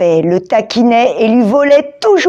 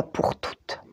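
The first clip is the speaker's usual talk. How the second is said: whispered under the breath; quiet determined whisper; whispered resolve; 17 dB quieter than usual. A narrator's reading. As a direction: murmur it to yourself, determined. Igor resolves to fight.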